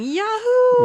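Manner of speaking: high, loud whooping "Yahoo!"; retold yahoo yell. Mason bursting through the door, excited whooping yell. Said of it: "Because he's yelling".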